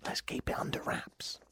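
hushed, voiceless aside; conspiratorial whisper. Pretend. Host confiding in listeners, secretive whisper. Mock secret about his business.

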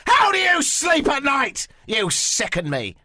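loud, high, drawn-out rant; mock outraged shout. Host berating choosy restaurant managers in mock outrage. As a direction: shout in hammed-up indignation.

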